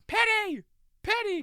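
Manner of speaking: high, loud repeated call; calling the dog. Retold or imitated. Rach calling her dog during the sad scene, high loud calls. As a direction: call out for the dog across the room. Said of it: "Rach kept going".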